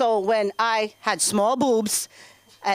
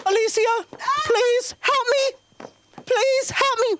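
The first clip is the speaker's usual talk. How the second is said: high, slow mock plea; high begging from inside the closet; pleading from the closet; 11 semitones higher, slower than usual. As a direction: whimper for help through the door. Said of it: "I'm in the closet".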